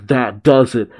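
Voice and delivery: usual speaking voice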